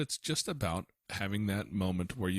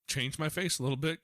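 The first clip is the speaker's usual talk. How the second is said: ordinary talk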